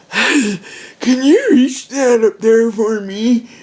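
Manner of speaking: put-on slow, feeble old lady voice; quavery old woman asking for help. Quoting an old woman with oxygen.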